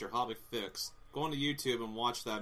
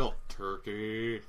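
drawn-out mock awe; much slower than usual. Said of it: "And everyone's like".

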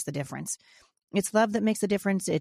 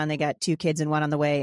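normal talking voice

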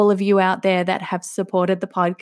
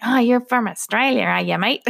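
lightly exaggerated Aussie accent, starting higher; mocking Australian accent; 3 semitones higher than usual. Driver making fun of her accent, mock Australian. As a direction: imitate someone teasing an Australian accent. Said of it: "he made fun of my accent".

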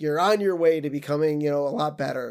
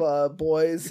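normal talking voice

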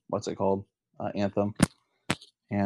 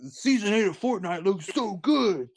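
mock hype; 9 semitones higher than usual. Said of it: "they were like".

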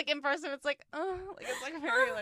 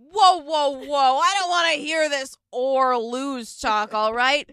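loud, higher rallying speech; Sam's pep talk; 7 semitones higher, 8 dB louder than usual. Retold or imitated. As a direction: pump up the team like a brash captain. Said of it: "She's like".

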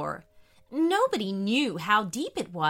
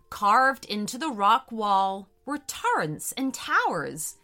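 ordinary delivery

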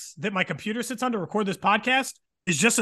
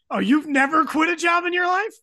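mock outrage; 6 semitones higher than usual. Real, not acted. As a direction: fire back in playful disbelief. Teasing co-host who accused him.

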